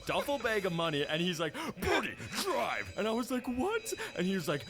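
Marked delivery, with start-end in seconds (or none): 1.8-2.9 high, drawn-out mock command; acted urgent order. Wahlberg to boy after bank robbery, mock urgent. 3.5-3.9 very high squeak of disbelief; acted shock. Boy told to drive getaway truck, mock shocked.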